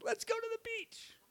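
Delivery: high pinched voice, breath held; strained, holding breath. Retold or imitated. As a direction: say it without breathing. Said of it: "He inhaled and said".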